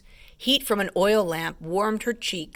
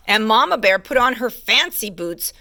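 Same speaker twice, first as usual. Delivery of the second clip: usual speaking voice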